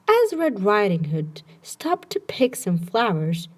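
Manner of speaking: plain reading voice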